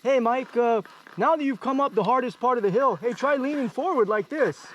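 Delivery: high-pitched imitation of a know-it-all rider; Mal Dalton. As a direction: pipe up high as the unasked adviser. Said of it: "Mal Dalton experience".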